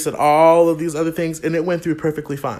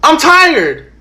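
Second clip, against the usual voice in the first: high, loud exasperated cry; fed up; 10 semitones higher, 9 dB louder than usual. Real, not acted. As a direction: burst out in frustration. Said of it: "Yeah, I'm fed up".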